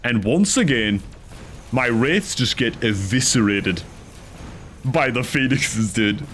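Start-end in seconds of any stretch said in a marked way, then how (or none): none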